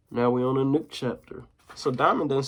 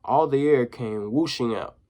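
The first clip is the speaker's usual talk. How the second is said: plain reading voice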